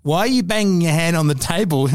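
usual speaking voice

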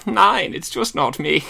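high, slow mock-German accent; squeaky German like a cartoon little pig; fail at a scary German accent, comically high; camp German. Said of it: "like the little pigs in".

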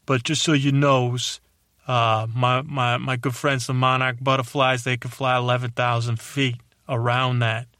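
put-on wise-guy New York accent with dropped r's; blunt city butterfly, thick New York accent; Bernie the Butterfly, tough New York accent. Butterfly setting humans straight, New York accent. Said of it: "that's why I got this accent".

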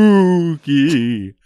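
loud, high, long wailing "spoooky"; hammed-up spooky. Host teasing a Halloween secret word, mock-ghostly wail. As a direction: stretch it out like a pretend ghost.

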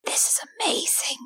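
awed whisper in character; whispering over the sleeping cat; hushed whisper. Acted. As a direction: breathe it out in wonder. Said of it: "in a hush whisper out loud in character".

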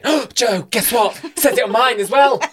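high, gushing fake excitement; squeaky excited mock voice. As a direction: squeal it like a giddy believer. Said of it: "'cause I think I'm funny, I've been going".

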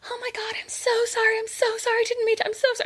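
put-on a little squeaky girl begging forgiveness. Girl caught peeing behind car apologises, squeaky voice. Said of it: "this other little squeaky voice going".

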